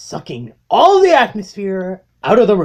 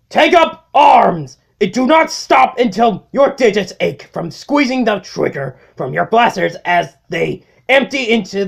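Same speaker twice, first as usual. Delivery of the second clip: loud, fiery commander's address; rallying war speech; 5 semitones higher, 5 dB louder than usual. Acted. Megatron rallying his Decepticons, loud and fiery. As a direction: rouse the troops to revenge.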